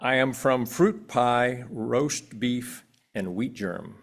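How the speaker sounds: ordinary talk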